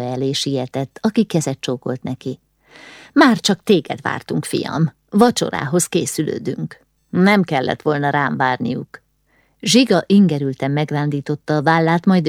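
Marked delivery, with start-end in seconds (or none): none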